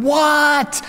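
high drawn-out mock yell; yelling back. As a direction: call back at top pitch, as to someone out of earshot. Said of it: "yell back as loud as I could".

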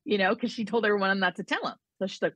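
ordinary talk, nothing marked

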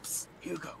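hushed "psst" to get attention; secretive whisper. Acted. Calling a friend hiding in the corner, secretive whisper. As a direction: whisper a name covertly across a room. Calling covertly to Hugo at party.

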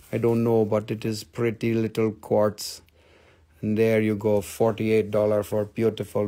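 deep, measured sales patter; low-voiced seller character. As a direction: describe it low and unhurried.

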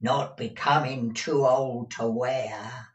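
mishear it pointedly; tag old housekeeper. A narrator's reading. Deaf Peg mishearing her master, old-servant retort.

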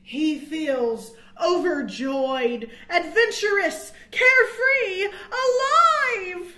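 rising ecstatic cry; building joy. A narrator's reading. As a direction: let the joy burst out. Squirrel gliding with joy.

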